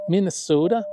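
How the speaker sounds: put-on a mild Minnesota accent on Minnesota; lightly drawing out Minnesota the local way. Ham radio operator imitating how Minnesotans say it, mild Minnesota accent. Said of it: "or as people up there might say".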